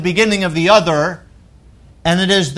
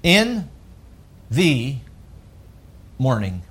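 each word set apart by long pauses, dropping lower and softer; slow emphatic phrase; 7 semitones lower, 6 dB quieter, slower than usual. Shown as a demonstration. Preacher stressing the words to his congregation, slow spaced falling delivery. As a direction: lay out In... the... morning... slowly, falling away. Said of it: "The next three words are vital".

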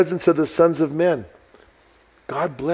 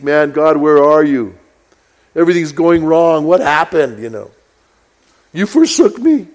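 despairing cry to God; 2 semitones higher, 7 dB louder than usual. Retold or imitated. Voicing despair in prayer.

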